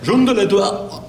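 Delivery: exaggerated mock French. Shown as a demonstration. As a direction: spit out fake French with flair. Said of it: "But French is like".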